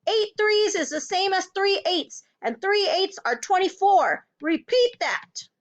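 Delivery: high, insistent drilling; angry headmistress, pitch held high; shouted. A narrator's reading. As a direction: hammer the sum into a pupil. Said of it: "the Trunchbull shouted".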